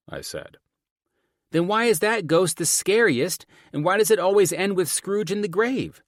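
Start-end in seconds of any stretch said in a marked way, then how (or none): 1.5-6.0 put-on high, loud arguing child; insistent daughter. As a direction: challenge Dad in a high kid voice.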